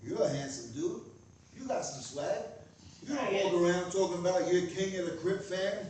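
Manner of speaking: ordinary talk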